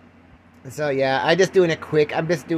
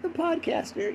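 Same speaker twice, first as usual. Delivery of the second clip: high, drawn-out doting coo; proud parents; 3 dB quieter, slower than usual. Acted. Imagined parents bragging about their podcaster son, hammed-up pride. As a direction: gush with exaggerated parental pride, fading softer. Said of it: "My mom and dad would be so proud".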